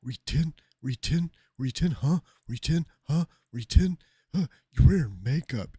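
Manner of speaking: loud, high, needling repeat; hammed-up yelling mimic of a heckler; mocking the heckler. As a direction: badger him like a schoolyard taunt. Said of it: "He's like".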